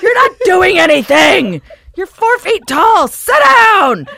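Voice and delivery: slow, high-pitched shouting; loud, drawn-out outraged yelling; furious heckle. Acted. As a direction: holler each phrase in exasperation. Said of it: "And I'd be like".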